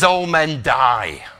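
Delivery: loud, slow, drawn-out warrior's declaration; William Wallace. Retold or imitated. Preacher quoting Braveheart's hero to the congregation, loud slow proclamation. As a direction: proclaim it like a rallying cry, each word stretched. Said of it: "I can't do a Scottish voice".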